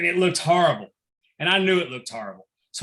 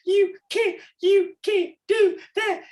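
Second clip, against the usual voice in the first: put-on very high, squeaky child voice with a gasp; gasp, then plead slowly in a tiny high voice; tearful little girl; 4 dB louder, slower than usual. Little girl protesting to the referee, mock near-tears. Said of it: "almost crying".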